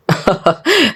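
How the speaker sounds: acted burst of chuckling; hearty laugh. Oday laughing at Nada's etiquette lesson, amused. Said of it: "ضحك جدًا".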